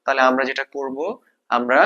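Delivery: ordinary talk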